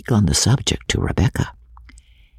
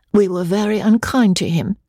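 blushing; 10 semitones higher than usual. A narrator's reading.

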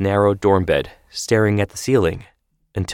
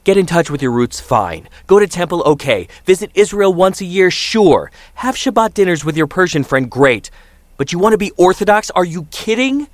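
high, loud, fast mounting rant; raging father; 10 semitones higher, 4 dB louder than usual. Acted. Father ranting at his son about going Orthodox, furious. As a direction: pile on the outrage, building. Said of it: "raged over Skype".

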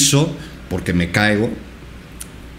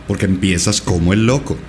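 ordinary delivery

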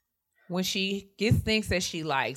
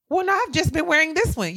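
imitating mom; 11 semitones higher, 3 dB louder than usual. Mom's voice quoted.

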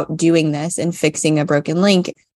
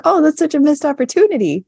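high exaggerated quote voice; 10 semitones higher than usual. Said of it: "I was like".

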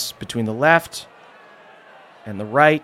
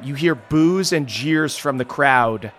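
normal talking voice

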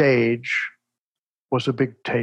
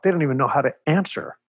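ordinary talk, nothing marked

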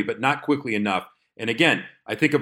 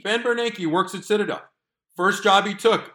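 much higher, mock-stunned repeat; the co-host echoes the punchline, pitched up in disbelief; incredulous disbelief; 9 semitones higher than usual. Real, not acted. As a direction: say it like you can't believe it. Incredulous at Bernanke at Citadel.

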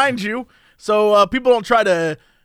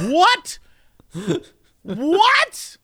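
disbelieving what; 10 semitones higher than usual. Retold or imitated. Retold shock at machine.

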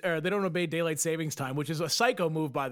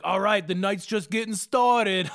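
slow, louder, hyped-up friends; mock party friends; 4 semitones higher, 5 dB louder, slower than usual. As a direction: drag it out like a party friend who won't quit. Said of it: "They're like".

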